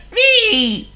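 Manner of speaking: very high, loud "wee"; squealed cat sound. A narrator's reading. Narrator showing the cat's cry, high squeal. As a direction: squeal it like the thin part of a meow.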